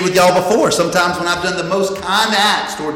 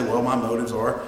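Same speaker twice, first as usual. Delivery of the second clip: low, subdued, grudging admission; half-hearted youngster owning up quietly; reluctant younger churchgoer; 5 semitones lower, 6 dB quieter than usual. Acted. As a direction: lightly voice a dutiful kid, lower and softer. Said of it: "some of us younger ones might say".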